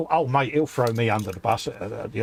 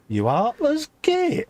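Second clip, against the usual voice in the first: high, slow "you heartless git"; mock scandalised wife, pitched up; wife's outraged retort; 12 semitones higher, slower than usual. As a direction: stretch it out, high and appalled. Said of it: "She looked at me and went".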